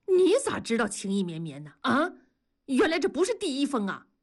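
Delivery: fast, pointed questioning; accusing. A narrator's reading. Wife catching her husband out over love letters, accusing. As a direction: fire off an indignant accusation.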